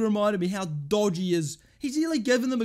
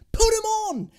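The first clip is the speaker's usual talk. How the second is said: high, loud angry demand; exasperated shout; 9 semitones higher, 6 dB louder than usual. Real, not acted. Fan demanding an unused striker play, shouting angrily. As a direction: yell it as an order.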